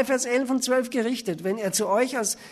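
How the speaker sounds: ordinary delivery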